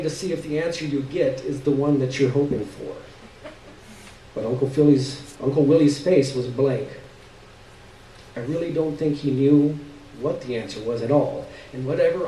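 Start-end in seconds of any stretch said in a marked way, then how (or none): none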